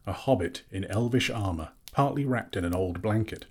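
plain reading voice

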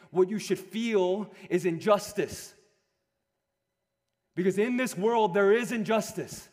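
high, loud, building sermon cry for justice; loud fervent preacher pleading with the congregation; impassioned preaching. Acted. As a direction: preach it to the room with rising urgency. Preaching at full force.

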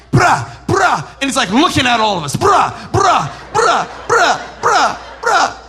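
imitating the kid strutting in at paintball; cocky middle schooler. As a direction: taunt like a cocky teen with a toy gun.

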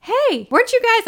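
high and loud, out of breath from running; breathless high call. Retold or imitated. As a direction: burst in high and loud, panting. Out of breath, confronting guys.

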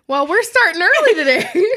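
high, laughing tease; mock scolding about drinking early; teasing. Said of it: "I was like".

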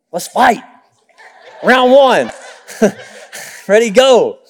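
laughing, playful dare to fight; mock challenge. Husband imitating his wife ready to argue, laughing. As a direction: tease it like a boxing announcer.

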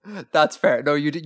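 normal talking voice

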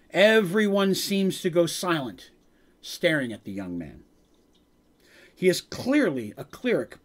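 measured storytelling voice; game master narration. A narrator's reading. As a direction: set the scene like a dungeon master. GM scene narration.

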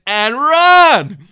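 loud, drawn-out cry to flee; rousing preacher's yell; urgent shout. Acted. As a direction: burst into a yell to get people moving. Urging flight from trouble.

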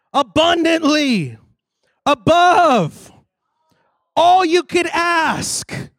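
high, loud, drawn-out scripture declaration; full-force preaching. Acted. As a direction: build each phrase into a slow shout.

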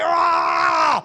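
long, high open-throated holler; loud acted scream of exasperation; angry venting yell. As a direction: let out a drawn-out frustrated roar. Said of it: "vent and get mad and say".

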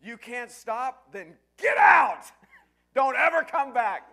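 huge, high, drawn-out furious shout; yelling at full volume. Retold or imitated. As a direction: roar it as loud as you can. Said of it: "I yelled as loud as I could".

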